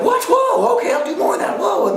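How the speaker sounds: very high, loud caricature of eagerness; mock overexcited. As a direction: ham up being thrilled by praise. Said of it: "they get positive feedback".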